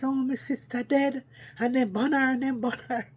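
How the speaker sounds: mock-distressed father's cry; upset. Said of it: "he was very upset, very upset".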